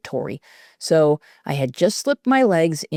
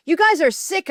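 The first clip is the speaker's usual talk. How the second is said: much higher, furious yell; 12 semitones higher than usual. A narrator's reading. Escaping girl yelling at her bullies. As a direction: shout in disgust. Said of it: "I yelled".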